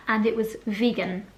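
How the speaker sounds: ordinary talk, nothing marked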